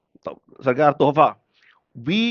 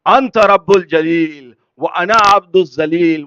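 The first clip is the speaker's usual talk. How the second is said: slow, louder, drawn-out declaration; solemn proclamation; 8 dB louder, slower than usual. Acted. Imam teaching Jibreel his answer, solemnly proclaimed. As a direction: proclaim each phrase slowly and forcefully.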